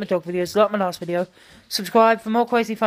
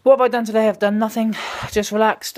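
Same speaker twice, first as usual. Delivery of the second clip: ordinary delivery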